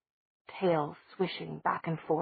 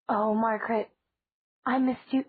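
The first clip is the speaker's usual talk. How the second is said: tender; 5 semitones higher, 3 dB louder than usual. A narrator's reading. Elena reunites with little sister.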